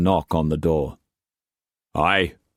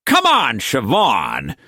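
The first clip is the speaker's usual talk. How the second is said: sharp; 7 semitones higher, 4 dB louder, slower than usual. Acted. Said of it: "Cooper interrupted sharply".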